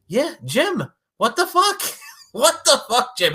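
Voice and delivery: high-pitched, incredulous squeal; exasperated disbelief. Acted. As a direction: demand an answer, voice shooting up. Scolding the absent commentator.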